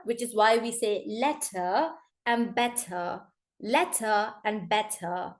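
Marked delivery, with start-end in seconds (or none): 1.1-3.2 slow letter and better, crisp T, no final R; British T, silent R. Shown as a demonstration. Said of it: "you overpronounce the T and you don't pronounce the R". 3.6-5.3 letter and better slowly, hard T and no R; British T, silent R. Shown as a demonstration. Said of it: "you overpronounce the T".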